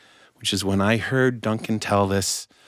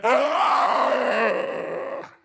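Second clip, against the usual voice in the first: long, high comic scream; horrified scream. Acted. Beggar learns the man really is king, horrified scream. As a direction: scream out in sudden panic.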